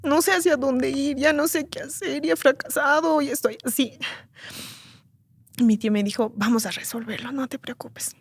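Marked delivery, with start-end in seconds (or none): 0.0-4.0 queja aguda y desvalida, en voz suave; llanto recreado. Retold or imitated. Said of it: "Y me puse a llorar". 6.3-8.2 tranquilizar bajito, casi en susurro al final; consuelo suave, recreado. Retold or imitated. Tío consuela a su sobrina.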